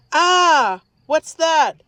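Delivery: loud, high alarmed yell. A narrator's reading. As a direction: shout at something on the track. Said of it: "Dad yelled".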